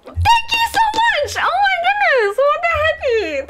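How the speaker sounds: high, loud squealing thanks; overwhelmed joyful squealing; ecstatic squeals. Real, not acted. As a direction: squeal with delight at the gift. Thanking for a gift sub.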